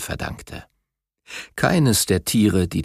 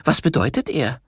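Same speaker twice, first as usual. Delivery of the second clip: higher, lightly voiced young tiger; young cub voice; 4 semitones higher than usual. A narrator's reading. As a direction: ask it as an eager cub. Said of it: "sagte Biru".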